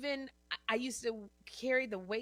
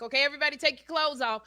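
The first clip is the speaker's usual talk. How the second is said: higher, loud, fast and laughing; mock director; 7 semitones higher, 11 dB louder, faster than usual. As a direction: rattle off the order like a careless director. Mocking a director on set.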